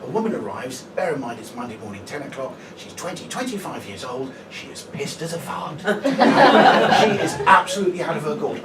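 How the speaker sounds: normal talking voice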